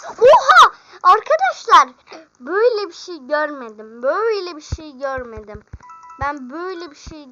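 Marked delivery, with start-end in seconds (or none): 0.1-5.6 overjoyed shout. Real, not acted. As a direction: scream with joy at it. Can't believe the gifts. (izleyicilere inanamayarak yüksek sesle bağırış)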